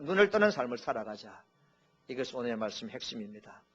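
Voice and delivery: ordinary talk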